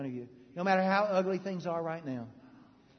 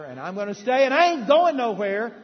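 louder, rising, defiant vow; defiant shout; 5 semitones higher, 12 dB louder than usual. Real, not acted. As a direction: declare it with all your force.

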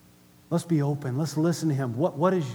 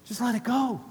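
Holy Spirit pleading; 6 semitones higher, 5 dB quieter than usual. Acted. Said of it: "And he's going".

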